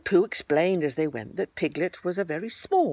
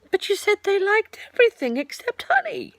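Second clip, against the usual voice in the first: much higher, rising puzzled objection; puzzled Pooh; 6 dB louder than usual. Acted. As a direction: catch him out gently.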